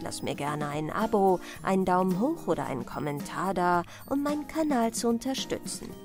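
usual speaking voice

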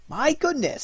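high-pitched, amused surprise; amused exclamation. A narrator's reading.

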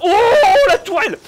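high, loud cry of shock; startled shout. Real, not acted. Gamer surprised by a turret, shouting high and loud. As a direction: yell at the turret that got you.

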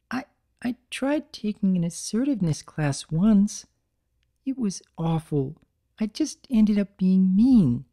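nervous mare confessing a failure; Fluttershy voice. Acted. Shy Fluttershy telling Big Mac about her class, timid.

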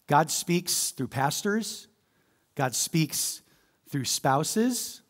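"God" rising, slowed; slow emphatic. Shown as a demonstration. Pastor listing who God speaks through, slow. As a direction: lay each word down. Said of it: "Look at me here".

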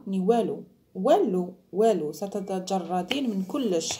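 والو punched and repeated; stress on والو. Real, not acted. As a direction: hit والو each time, slow. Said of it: "emphasis on walou".

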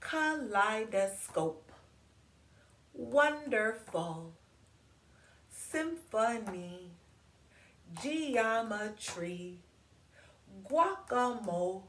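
slow, each syllable stretched; long words read slowly with space between; drawn out, song-like. Shown as a demonstration. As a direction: savor every syllable slowly. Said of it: "words that sounded like songs".

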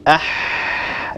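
أح breathed out long and voiceless from the throat; voiceless ha sound. Shown as a demonstration. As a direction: push air through a narrow throat for أح. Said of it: "لاحظوا".

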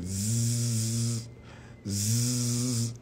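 ordinary talk, nothing marked

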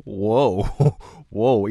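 startled whoa; surprised. Real, not acted. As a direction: caught off guard, gasp it out. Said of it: "even I'm surprised now".

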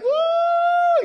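very high, loud whoop. Acted. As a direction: whoop like someone being lifted skyward. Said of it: "you're like".

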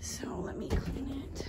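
slow breathy whisper; hushed aside. Real, not acted. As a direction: whisper it to yourself.

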